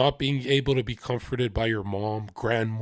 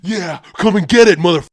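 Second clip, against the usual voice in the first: much higher, much louder screaming rant; furious yelling; 10 semitones higher, 15 dB louder, faster than usual. Retold or imitated. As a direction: scream her out of the apartment in a rage. Screaming Stacy out of apartment.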